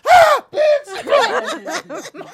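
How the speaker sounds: high, loud scream into a curse; reenacted scream. Host reenacting his own scream at the scary scene, screaming. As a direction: shriek it like a jump scare. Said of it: "I went".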